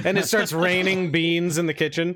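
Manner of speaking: ordinary talk, nothing marked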